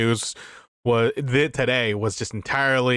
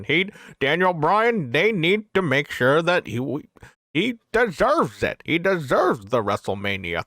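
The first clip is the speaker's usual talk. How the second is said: put-on accent with big, high swoops in pitch; Pat Patterson impersonation; 4 semitones higher than usual. Host imitating Pat Patterson backing a wrestler, swooping impersonation. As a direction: draw the words out in an exaggerated impression. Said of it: "that's my Pat Patterson impersonation".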